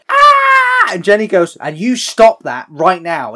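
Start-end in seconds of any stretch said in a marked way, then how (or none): 0.1-0.9 very high, loud mock scream; Leatherface roar. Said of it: "Leatherface gets up and goes". 1.6-3.3 loud, slow, high telling-off; mock scolding. Jenny scolds Leatherface in retelling.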